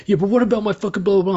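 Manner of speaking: high, loud whiny mock rant; a loud, high put-on voice of a ranting offender; mocking angry offender. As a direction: sneer it like an aggrieved inmate. Offender ranting back at him.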